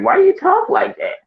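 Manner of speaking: high, slow, incredulous mock voice; mocking relatives. Family teasing the returned couple, high mock voice. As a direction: pitch it way up like a puzzled cousin. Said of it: "they like".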